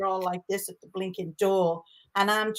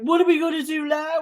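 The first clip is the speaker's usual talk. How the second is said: higher, louder panicked question; panicky; 7 semitones higher, 7 dB louder than usual. Retold or imitated. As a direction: sound lost and panicking. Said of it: "Our David's like".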